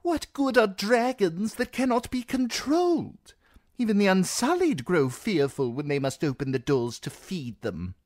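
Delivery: nervous seneschal pressing his fear; fretful seneschal. Acted. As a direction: fret as if the sky is falling. Reznak frets about dragons.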